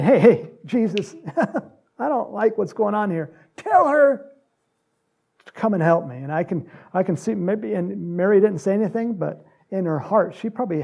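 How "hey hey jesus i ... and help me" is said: laughing, rising whiny complaint, pitch jumping up at tell her; Martha complaining. Retold or imitated. Martha complaining to Jesus about her sister, whiny and laughing. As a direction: play the harried sister protesting to Jesus with a laugh. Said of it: "Martha was coming in and saying".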